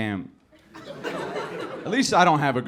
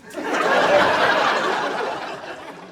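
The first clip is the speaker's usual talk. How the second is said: burst of laughter. Real, not acted. Laughing at own joke.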